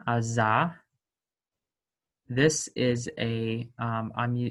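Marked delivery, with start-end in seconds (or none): 0.1-0.7 "aza" with a buzzing Z; demo of Z. Shown as a demonstration. Teacher to students, sounding out the Z. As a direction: buzz the Z in "aza". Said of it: "a Z sound".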